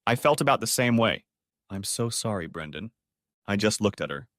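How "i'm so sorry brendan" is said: much softer, fading to near silence on the name; quiet apology. A narrator's reading. Ashamed wife apologises to husband, fading softly. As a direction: barely get the sorry out. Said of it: "she looked very unhappy".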